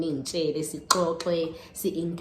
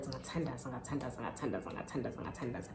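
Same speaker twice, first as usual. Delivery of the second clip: hushed repetition; 5 semitones lower, 12 dB quieter, faster than usual. Real, not acted. Repeating that she prayed, hushed.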